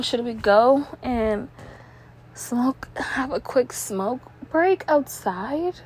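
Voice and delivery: ordinary talk, nothing marked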